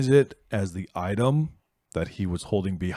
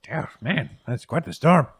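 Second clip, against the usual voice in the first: put-on old man voice; 7 semitones higher, 6 dB louder than usual. Herb marvelling at storm.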